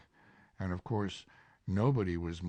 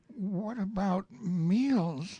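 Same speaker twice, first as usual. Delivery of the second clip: lightly voiced Winnie-the-Pooh, polite; 12 semitones higher, 5 dB louder, much slower than usual. Pooh slow.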